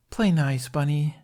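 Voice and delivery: high, lilting playful voice; playful scolding. A narrator's reading. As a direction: chide him jokingly, high. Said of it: "Tooth joked".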